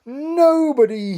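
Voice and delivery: high, loud, slow nobody; king fondly to the queen; tender king. Acted. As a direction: say it fondly to her. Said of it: "as he kissed her tenderly".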